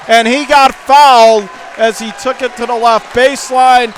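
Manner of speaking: high, loud rapid-fire play-by-play; excited call. Real, not acted. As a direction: call every bounce at full volume. Frantic final possession call.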